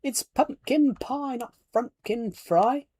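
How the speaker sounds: high, slow, wide-eyed giant voice; BFG giant quote. Retold or imitated. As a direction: slowly quote the friendly giant, pitched high. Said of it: "of The Big Friendly Giant".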